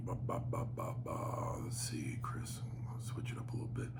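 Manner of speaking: very quiet, to himself; half-whispered filler; absent-minded mumbling. Real, not acted. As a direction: mumble softly while looking through something. Mumbling while scrolling through emails.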